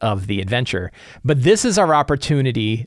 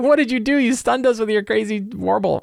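startled accusation; 8 semitones higher than usual. Retold or imitated. Players accusing the stunned kid.

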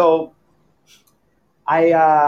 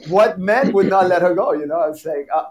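normal talking voice

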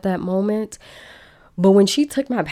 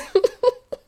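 a sudden squeaky burst of laughter; high laugh. Real, not acted. Laughing at how sure she was of a dream trip, high giggle. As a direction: let a high giggle escape. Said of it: "I am cracking up".

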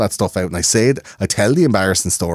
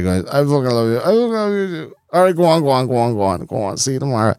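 put-on drunk goodbye; 4 semitones higher than usual. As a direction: gush it at closing time. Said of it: "about to get into a taxi".

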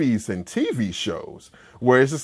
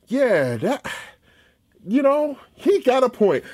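higher, grudging nod; grudging agreement; 9 semitones higher than usual. Retold or imitated. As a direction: concede the point, half laughing. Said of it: "It's like".

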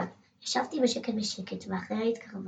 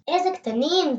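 higher, louder delighted cry; delighted shout; 6 semitones higher, 6 dB louder than usual. Acted. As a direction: shout with delight at the tiny hedgehogs. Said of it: "צעקתי". (צעקה נלהבת ומתפעלת)

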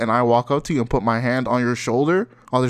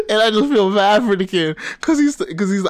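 high-pitched, talking through laughter; tickled, voice pushed up by giggles; laughing; 8 semitones higher, 4 dB louder than usual. Real, not acted. Friend feeling bad for the kid, laughing. Laughing while telling the story.

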